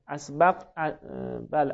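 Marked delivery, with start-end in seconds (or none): none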